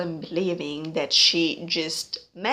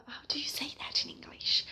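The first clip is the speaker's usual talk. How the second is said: a quick private whisper while hunting for the word; whispered aside; 8 semitones higher, 9 dB quieter, much faster than usual. Real, not acted. Vlogger whispers to herself while searching for an English word, whispering. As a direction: whisper the question to yourself, fast and unvoiced.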